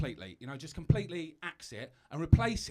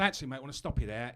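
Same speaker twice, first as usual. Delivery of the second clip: ordinary talk, nothing marked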